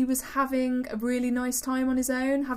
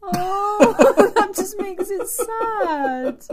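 loud, slow words squeezed through laughter; real cracking up, higher and drawn out; helpless laughing; 4 semitones higher, 7 dB louder, much slower than usual. Real, not acted. Laughing at sad neighbour story.

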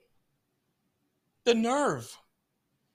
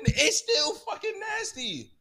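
slow drawn-out disgust; 9 semitones higher, slower than usual. Acted. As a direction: stretch each word out, slow and higher.

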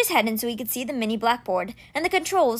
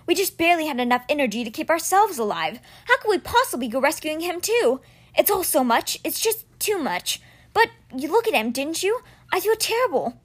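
put-on higher, overwhelmed boy voice; guilt-ridden boy Alex; 6 semitones higher than usual. Boy confessing to his friend that he feels terrible, upset. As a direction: sound swamped by guilt.

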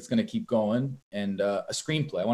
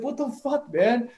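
retold outrage; 11 semitones higher than usual. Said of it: "So I was like".

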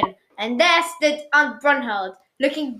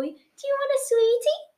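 very high-pitched coaxing; doting aunt. A narrator's reading. Aunt offers her favourite nephew a sweet, high doting voice. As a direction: coax a small child in a high voice.

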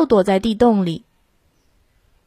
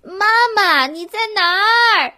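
yelling across the room; 12 semitones higher than usual. A narrator's reading. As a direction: shout for Mom from the stairs. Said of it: "大声喊".

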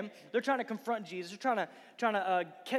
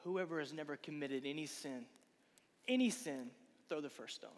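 quoting Jesus; 5 semitones lower, 7 dB quieter than usual. Retold or imitated. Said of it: "That's my paraphrasing".

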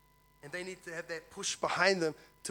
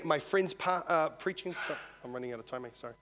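usual speaking voice

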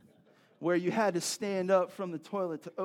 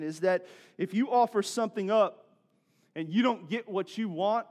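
rising sermon push; 3 semitones higher, 5 dB louder than usual. Acted. Preaching at full force.

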